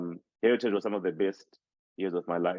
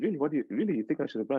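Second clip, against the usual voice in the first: surprised; 7 semitones higher, much faster than usual. Retold or imitated. Acted surprised reply to school's suggestion.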